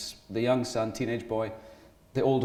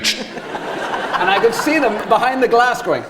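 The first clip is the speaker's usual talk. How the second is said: normal talking voice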